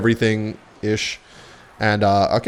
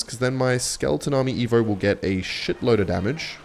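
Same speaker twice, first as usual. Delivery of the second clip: usual speaking voice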